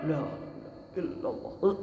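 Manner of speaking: breathy dying whisper, barely audible; faint unvoiced film whisper; movie-style whisper. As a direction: whisper it like a dramatic film deathbed. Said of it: "Bukan kayak di film-film".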